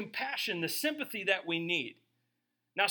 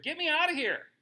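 higher, louder mock plea to escape; mock panic; 8 semitones higher, 3 dB louder than usual. Pastor imagining himself on the cross, mock frantic plea. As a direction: play up a frantic half-joking cry. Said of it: "I would have declared".

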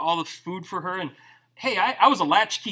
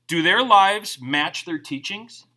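slow, deliberate; 3 dB louder, slower than usual. Real, not acted. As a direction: Do their lives match their teachings said slowly, each word weighed. Slow emphatic question.

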